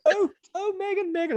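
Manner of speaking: mock starstruck fan. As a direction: ham up a posh crowd gushing over a royal.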